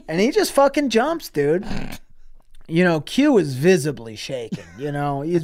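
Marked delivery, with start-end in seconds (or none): none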